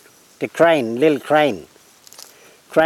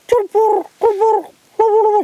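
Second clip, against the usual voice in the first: very high repeated bird cry; butcherbird call; 4 dB louder, slower than usual. Retold or imitated. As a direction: sing out the name as the bird would.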